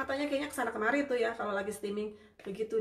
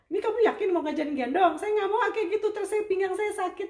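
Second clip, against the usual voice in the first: high, louder half-joking complaint; mock protest; 10 semitones higher, 7 dB louder than usual. Mother retelling her complaint to the therapist, mock protest. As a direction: protest playfully that your back will hurt. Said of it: "saya udah bilang sama terapisnya".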